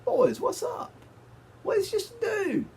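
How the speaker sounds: very high, slow put-on voice, swaggering; hammed-up squeaky bravado; cocky squeak. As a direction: play the cocky kid brother shrugging off a giant. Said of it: "I was like".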